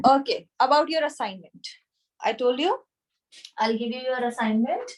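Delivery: ordinary talk, nothing marked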